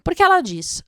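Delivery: ordinary talk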